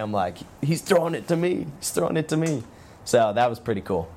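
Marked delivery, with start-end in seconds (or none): 0.6-2.6 excited. Retold or imitated. Excited inner thought, high.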